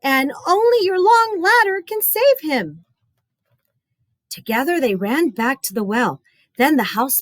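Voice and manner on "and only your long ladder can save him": put-on high pleading boy; crying out. Boy begging the painter, high pleading cry. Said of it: "he cried".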